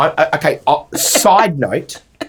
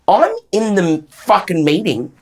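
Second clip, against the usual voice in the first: usual speaking voice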